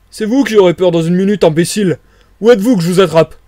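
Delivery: irate shouting; angry threat. Acted. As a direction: threaten the hidden joker at full voice. Marvel threatens the hidden voice.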